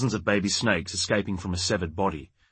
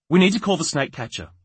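sharp, higher and louder; 8 semitones higher, 5 dB louder than usual. A narrator's reading. Impatient wife tells husband what to do, sharply. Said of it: "she said sharply".

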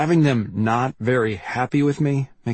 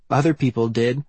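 put-on girl's voice. A narrator's reading. Branley's quoted line.

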